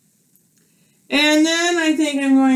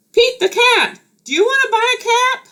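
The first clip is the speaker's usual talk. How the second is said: much higher, quick hopeful call; peddler calling; 14 semitones higher than usual. Acted. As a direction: call up to the cat. Peddler calls to Pete.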